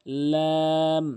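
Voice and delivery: লাম held very long; slow, stretched model of লাম; drawn-out lam. Shown as a demonstration. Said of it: "সহজ বললেই হবে".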